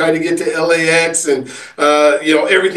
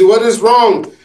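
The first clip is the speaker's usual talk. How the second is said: bad Caribbean accent, pitched higher and louder; island accent; 6 semitones higher, 3 dB louder, slower than usual. The concierge asking the frazzled guest what's wrong, in a bad Caribbean accent. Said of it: "obviously my accent's terrible".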